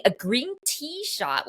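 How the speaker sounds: ordinary talk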